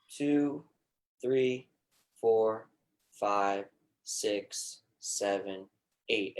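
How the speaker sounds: counting the breath slowly, fading on the exhale; guided breathing count. Shown as a demonstration. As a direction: lead the breathing count calmly. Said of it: "And we'll start".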